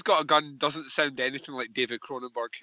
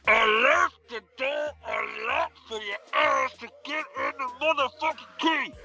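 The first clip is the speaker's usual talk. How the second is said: Michael Myers mask voice; 9 semitones higher than usual. Pretend. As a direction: shout it through a horror mask. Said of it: "do your [name] and a Michael Myers mask voice".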